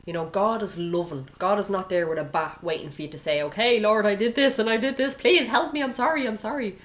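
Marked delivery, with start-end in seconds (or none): 3.4-6.8 high-pitched, louder begging for forgiveness; hammed-up panicky apology; mock frantic pleading. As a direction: plead as if about to be hit. Acting out a frantic confession to God.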